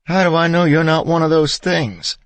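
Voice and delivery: high, loud, panicky demand; terrified wife doubting her husband; frightened shout. A narrator's reading. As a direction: challenge him out of fear.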